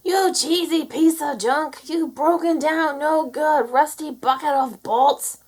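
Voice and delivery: loud, high, slow angry insults at the tractor; farmer's angry shout. A narrator's reading. Minnie yelling the farmer's curse at the tractor. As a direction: bellow like a fed-up farmer. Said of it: "The ones the farmer shouts".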